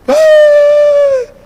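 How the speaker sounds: high, loud mimicked "ay"; imitated pain yelp. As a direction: yelp like a hurt boy. Imitates injured boy's yelp. (quejido de dolor imitado, agudo)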